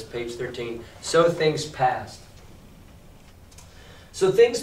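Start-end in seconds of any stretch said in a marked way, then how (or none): 1.0-2.2 each word slow and spaced; slow read-out. Shown as a demonstration. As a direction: point out the words slowly. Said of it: "where it says".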